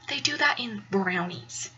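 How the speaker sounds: hushed, unvoiced and low; whispered know-it-all. Alita explaining to her roommate in a whisper. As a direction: whisper it slowly, like an obvious secret. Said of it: "I was like".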